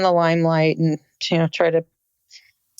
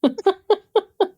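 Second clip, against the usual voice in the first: a quick high-pitched burst of laughter; real giggle, pitched well up; short high giggle; 13 semitones higher than usual. Real, not acted. Laughs about disco on cruise.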